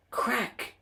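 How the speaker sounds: high sharp crack; crack sound. A narrator's reading. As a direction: snap it out like something breaking. Safety valve bursts.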